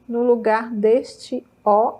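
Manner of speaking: ordinary delivery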